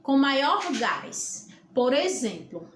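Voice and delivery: ordinary delivery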